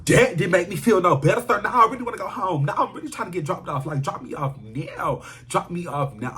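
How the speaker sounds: agitated, pushing to be dropped off; upset demand. Retold or imitated. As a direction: insist on getting out of there. Acting out upset demand to leave.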